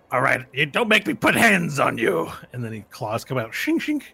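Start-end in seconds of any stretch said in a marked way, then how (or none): 0.1-2.3 threatening tabaxi. Acted. 3.5-4.0 high metallic "shink, shink"; claw sound effect. Acted. Claws come out, high imitated shink.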